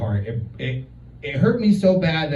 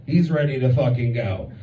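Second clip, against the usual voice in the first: ordinary talk, nothing marked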